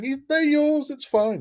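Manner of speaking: high-pitched, loud comic panic; squeaky hammed-up surrender; mock panicked retreat. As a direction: imitate a gang leader fleeing, voice shooting up. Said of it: "the leader running off going".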